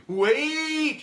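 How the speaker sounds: high, loud cry of wait; calling out. Retold or imitated. Prospect calling out to stop the call, high cry. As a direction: call them back before they hang up.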